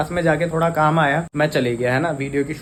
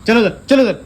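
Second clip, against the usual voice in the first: shooing the dog; 9 semitones higher, 8 dB louder than usual. Real, not acted. Shooing the puppy.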